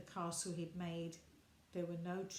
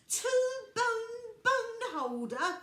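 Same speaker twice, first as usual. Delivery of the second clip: high, slow, sung-out disbelief; dismay; 14 semitones higher, 11 dB louder, slower than usual. A narrator's reading. Little Bear hears Dog's silly name for trousers, dismayed. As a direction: stretch it out in shocked dismay. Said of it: "cried Little Bear in dismay".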